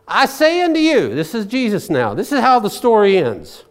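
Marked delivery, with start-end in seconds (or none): 0.0-1.2 preaching Jesus' words. Acted. Quoting Jesus, high and emphatic.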